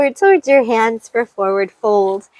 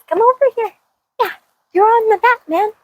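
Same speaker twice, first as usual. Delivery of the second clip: much higher coaxing voice for a dog; high pet voice; 11 semitones higher, much faster than usual. Real, not acted. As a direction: coax the dog over in a high voice.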